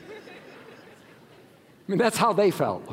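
breathy laugh through the words. Real, not acted. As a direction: laugh as you say it. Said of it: "laughing aside".

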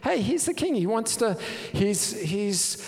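high-pitched, incredulous questioning; what-is-it-to-you exasperation; exasperated challenge. Acted. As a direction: plead his case in rising indignation. Challenging Michal's scorn of David.

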